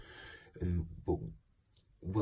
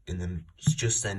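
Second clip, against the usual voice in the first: ordinary talk, nothing marked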